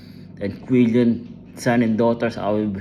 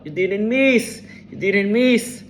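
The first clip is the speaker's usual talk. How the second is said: shouting; 8 semitones higher than usual. Retold or imitated. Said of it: "they will shout".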